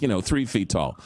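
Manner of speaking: normal talking voice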